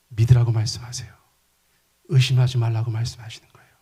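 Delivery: whispered emphasis; hushed pastor's whisper. Real, not acted. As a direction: lean in and whisper the point.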